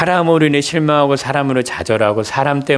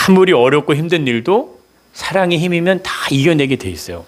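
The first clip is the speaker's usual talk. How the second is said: normal talking voice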